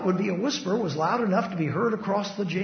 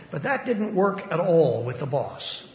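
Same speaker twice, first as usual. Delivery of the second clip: ordinary delivery, nothing marked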